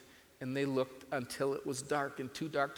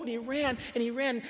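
urgent; 7 semitones higher, 5 dB louder than usual. A narrator's reading. Chasing the boat.

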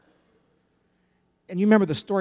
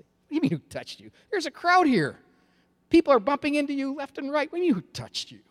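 much higher, louder disbelief; incredulous disciples; 12 semitones higher, 4 dB louder than usual. Acted. Disciples scoffing at Jesus in the crowd, in much higher incredulous disbelief. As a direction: throw it back at him, unable to believe the question. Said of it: "The disciples are incredulous".

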